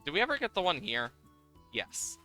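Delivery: usual speaking voice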